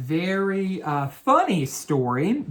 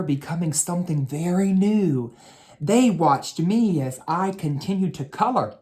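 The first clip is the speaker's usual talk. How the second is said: story narration. A narrator's reading. Teacher reads the crown story to children, storybook narration. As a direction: read it like a bedtime story for kids. Reading a picture book to children.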